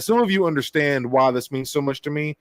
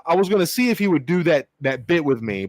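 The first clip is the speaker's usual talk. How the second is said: ordinary delivery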